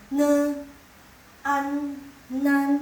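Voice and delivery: "Ne, an, nan" loud, high and level; sounding out syllables. Shown as a demonstration. Teacher combines consonant and final, loud level sounding-out. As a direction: blend "Ne" and "an" into "nan", separately. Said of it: "ditambahin dengan konsonan an".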